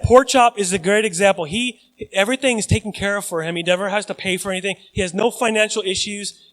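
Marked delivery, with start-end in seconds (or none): none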